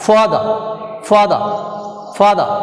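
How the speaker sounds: "Father" with a forceful FA, then a fall; teacher modelling "Father", stressed at the start; first-syllable stress. Shown as a demonstration. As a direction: punch FA, drop the end of "Father". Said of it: "stress is first syllable".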